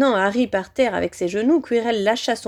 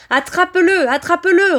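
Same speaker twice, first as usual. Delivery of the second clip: frantic high, loud repeated order; shouted command; 9 semitones higher, 7 dB louder than usual. A narrator's reading. Villain urges servant to seize the boy, shouting. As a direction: yell it twice, urgently and high. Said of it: "répéta Voldemort".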